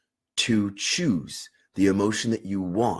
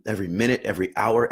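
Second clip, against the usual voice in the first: ordinary talk, nothing marked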